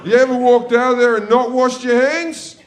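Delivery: high, loud outraged question; indignant. Acted. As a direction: exclaim it in comic disbelief. Indignant complaint about attendants' looks.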